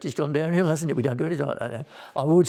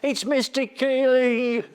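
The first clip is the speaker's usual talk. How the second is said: high drawn-out call of his name; calling out across the street; mock street shout; retold shout; 13 semitones higher, 4 dB louder, slower than usual. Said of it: "somebody shouted".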